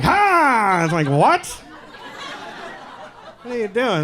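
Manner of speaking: put-on a kid unimpressed by dad's trick; mock child. Child reacting to Got Your Nose.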